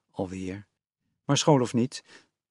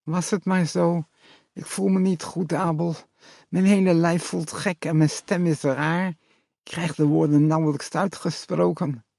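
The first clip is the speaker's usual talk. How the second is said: dentist-numbed voice; 6 semitones higher, 3 dB louder than usual. A narrator's reading. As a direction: force the words out through a numb mouth. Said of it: "opnieuw met die rare tandartsstem".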